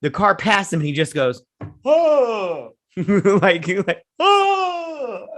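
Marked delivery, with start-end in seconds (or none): 1.8-2.7 a higher, short surprised grunt; imitating a feeble reaction to the hit; mock pained oh. Imitating man hit by car. 4.2-5.3 much higher, wailing mock cry; hammed-up cry. Imitating man hit by car.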